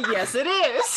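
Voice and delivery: loud, high, gleeful reply; cackling as you own up; laughing confirmation. Real, not acted. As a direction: confirm it through real laughter.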